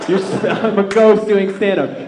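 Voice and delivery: normal talking voice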